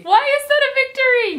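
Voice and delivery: high, loud disbelief; incredulous. Real, not acted. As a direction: shoot up in baffled disbelief. Questioning the book's logic.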